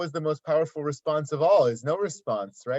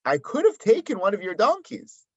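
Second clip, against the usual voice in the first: higher, louder insistence; Moshe pleading his case; 6 semitones higher, 5 dB louder than usual. Acted. Moshe to the people, insisting loudly. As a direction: press the point hard. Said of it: "Moshe is saying".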